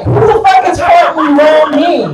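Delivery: high, loud indignant shout through a laugh; mock outrage. Comic outraged at a stranger's fart in the room, laughing shout. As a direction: yell it like the smell offends you.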